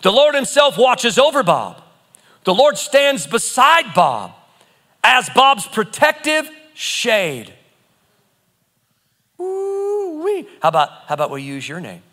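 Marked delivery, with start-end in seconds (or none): 0.0-7.5 slow, deliberate proclamation; slow decree. Acted. Decrees Psalm with own name. 9.4-10.5 high delighted ooh-wee; a thrilled cry after the verse; excited whoop. Real, not acted. Reacts after decree.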